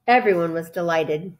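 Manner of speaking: usual speaking voice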